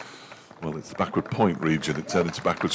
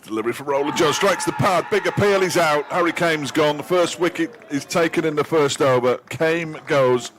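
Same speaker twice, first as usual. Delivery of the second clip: high, loud, thrilled shout of out; excited wicket call; 9 semitones higher, 4 dB louder than usual. Real, not acted. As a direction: shout the appeal and the wicket in delight. Wicket call, first over.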